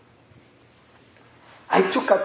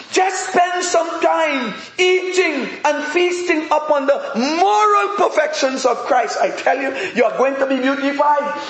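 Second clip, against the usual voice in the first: fervent preaching; 5 semitones higher than usual. Acted. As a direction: preach it, lift every quote. Preaching at full force.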